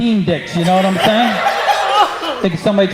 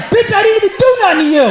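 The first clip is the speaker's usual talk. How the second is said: indignant mock yell; 10 semitones higher, faster than usual. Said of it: "Be like".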